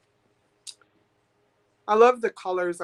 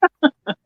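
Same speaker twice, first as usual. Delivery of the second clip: short loud happy laugh; a bright delighted laugh; burst of laughter; 6 semitones higher, 8 dB louder than usual. Real, not acted. Bid comes in.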